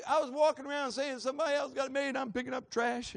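high, loud mock whine; loud complaining. Trash collector griping that others have it made, loud whine. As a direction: re-enact your own griping at full volume. Preacher re-enacts his own complaining.

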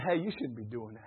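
a small inner voice tapping your shoulder; quiet nudge of conscience. Pretend. Preacher voicing the Holy Spirit as a soft inner warning.

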